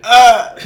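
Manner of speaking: sudden high mock yelp of alarm; startled yelp. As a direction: yelp like a caught-out kid. Kid startled by birthday question.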